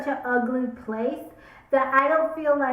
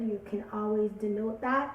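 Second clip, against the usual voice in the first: ordinary talk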